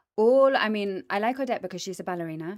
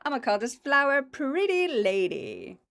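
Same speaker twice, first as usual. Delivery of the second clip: high, loud, gushing put-on voice of an old gardener; imitating Italian gardener; 8 semitones higher, 6 dB louder than usual. Italian gardener naming the belladonna flower, high and loud. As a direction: gush over the flower as a smitten gardener. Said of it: "some Italian gardener was like".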